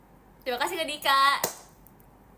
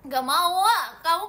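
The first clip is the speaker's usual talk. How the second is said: loud, high protest rising at the end; loud refusal; 5 semitones higher, 9 dB louder, slower than usual. Real, not acted. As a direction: refuse with a sudden yell. Refuses a viewer's request loudly. (menolak dengan teriak)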